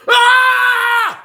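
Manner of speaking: high scream. Retold or imitated. Predator screams inside the cell, high scream. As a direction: scream like your life is over.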